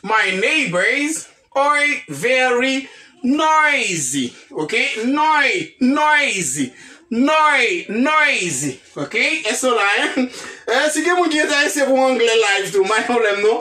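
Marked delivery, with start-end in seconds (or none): 0.0-4.3 slow model sentence. Shown as a demonstration. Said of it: "teacher models full sentence slowly". 5.1-8.8 noisy broken up, noi- then noisy; syllable drill. Shown as a demonstration. Teacher drilling noisy for the class. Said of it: "syllable drill of noisy".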